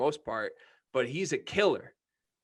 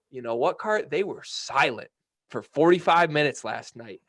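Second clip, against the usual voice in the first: ordinary talk, nothing marked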